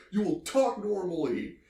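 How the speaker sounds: high-pitched indignant order; mock angry father. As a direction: lay down the law as an imitated outraged dad. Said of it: "The father gets so mad".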